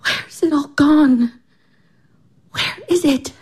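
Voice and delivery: tearful, breathy whisper; lost and crying under her breath. Acted. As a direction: weep the question out. Irina crying in despair.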